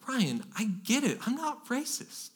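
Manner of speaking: high, soft protest; objecting listener. Acted. Listener excusing themselves to the pastor, soft protest.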